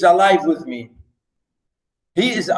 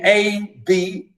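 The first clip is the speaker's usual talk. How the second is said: normal talking voice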